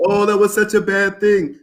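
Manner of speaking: high-pitched mock lament, imitating a pessimist; mock dismay. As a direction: whine it like someone who sees only the loss. Mocking a negative view of loss.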